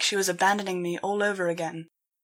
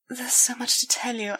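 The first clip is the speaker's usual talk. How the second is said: whisper; 4 semitones higher than usual. Acted. Hesitant confession.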